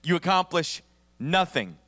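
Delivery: ordinary delivery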